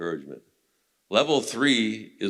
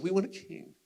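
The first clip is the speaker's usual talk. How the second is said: much higher, softer demanding voice; people demanding; 10 semitones higher, 6 dB quieter than usual. Retold or imitated. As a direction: voice the crowd asking for a king. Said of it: "the people said".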